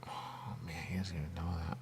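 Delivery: near-whisper, very soft and quick; hushed aside. Real, not acted. Aside about someone who doesn't know, hushed. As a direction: say it under your breath.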